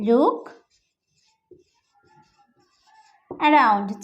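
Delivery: "look" then a long pause, then "around"; word by word. Shown as a demonstration. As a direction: split the phrase for repeating. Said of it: "তো এটাকে ইংলিশে বলবো".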